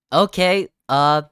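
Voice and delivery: jumpy, loud and high; a nervous startled blurt. Real, not acted. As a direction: caught off guard by something creepy. Spooked by the game's poem.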